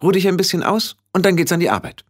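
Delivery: hohe, schnelle Stimme einer Hexe; zügig und bestimmt anpacken; resolute Hexe. Acted. (resolute Hexenstimme, hoch und flott)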